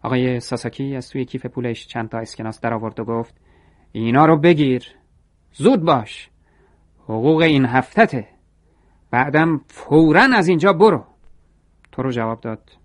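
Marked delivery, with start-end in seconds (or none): 3.9-11.0 angry shout. A narrator's reading. Manager throws out employee with pay.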